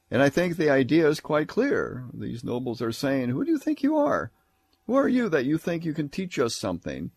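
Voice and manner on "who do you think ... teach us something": higher-pitched mock indignation; sarcastic challenge. Nobles retorting to Isaiah's message, acted sarcastic challenge. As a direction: throw it out as an affronted, scornful challenge. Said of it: "understand the sarcasm of what is being said".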